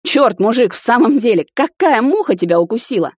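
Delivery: exasperated protest. A narrator's reading. Prince struggling up to confront his friend, loud exasperation. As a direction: demand an explanation, exasperated.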